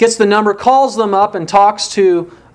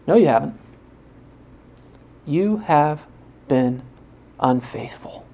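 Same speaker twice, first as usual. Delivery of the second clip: quiet rebuke; 3 semitones lower, 5 dB quieter than usual. Real, not acted.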